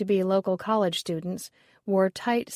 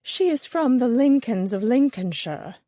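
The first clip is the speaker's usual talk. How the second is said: mock-posh British accent, higher and louder; haughty British accent; 4 semitones higher, 5 dB louder than usual. Jessica mocking the snobbish coin appraiser, haughty British accent. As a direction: lampoon her snobbery with a lofty British accent. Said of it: "broke out into a haughty British accent".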